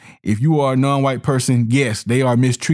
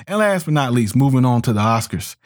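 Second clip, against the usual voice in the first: ordinary delivery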